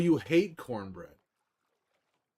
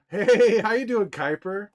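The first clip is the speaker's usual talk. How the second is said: excited hello; 9 semitones higher, 10 dB louder, slower than usual. Real, not acted. Greeting a chat viewer warmly.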